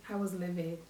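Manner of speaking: ordinary talk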